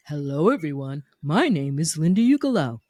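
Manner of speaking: character demo introduction. Shown as a demonstration. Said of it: "Or you can say".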